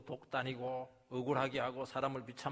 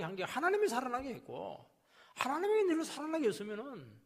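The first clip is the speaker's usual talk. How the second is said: high, loud fervent preaching that keeps climbing; fiery preaching; 10 semitones higher, 7 dB louder than usual. Acted. Pastor rebuking a complaining believer, fiery preaching. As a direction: drive the point home to the congregation.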